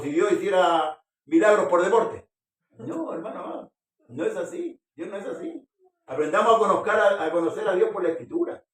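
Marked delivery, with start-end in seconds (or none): 2.8-8.6 higher, louder preaching push; emphatic preaching. Acted. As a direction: drive the point home to the flock. Preaching at full force. (predicación enfática y fuerte)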